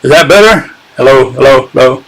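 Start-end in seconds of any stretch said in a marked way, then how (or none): none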